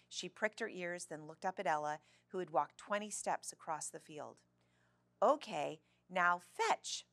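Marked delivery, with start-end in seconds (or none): 5.2-7.0 loud, crisp command to a dog; raised-voice training command; calling out. A narrator's reading. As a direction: call the order across a field. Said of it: "Ella called".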